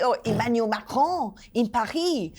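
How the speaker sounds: exaggerated French pronunciation; French accent. Presenter mocking over-correct foreign names, in a French accent. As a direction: put on a showy French accent. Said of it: "like, French".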